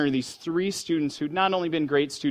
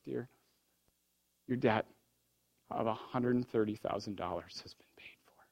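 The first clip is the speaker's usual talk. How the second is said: whispered; 5 semitones lower, 10 dB quieter than usual. Retold or imitated. President tells the third student.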